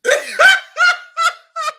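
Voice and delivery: loud, high cackling laugh; real burst of laughter. Real, not acted. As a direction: crack up helplessly at what was just heard. Cracks up at the clip.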